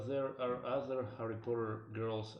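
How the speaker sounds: normal talking voice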